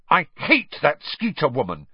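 sudden fierce burst; savage outburst. A narrator's reading. Hermione raging about Rita Skeeter, a fierce outburst. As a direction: spit it out, furious. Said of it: "she burst out savagely".

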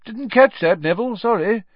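high, loud, cheery teacher; cheerfully asking for a repeat. A narrator's reading. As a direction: call it brightly to a shy pupil. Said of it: "said Professor Lupin cheerfully".

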